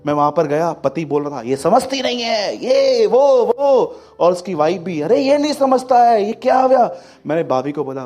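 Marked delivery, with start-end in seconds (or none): 1.4-4.0 complaining husband. Retold or imitated. Quarrelling husband voiced. 5.0-7.0 complaining wife. Retold or imitated. Quarrelling wife voiced.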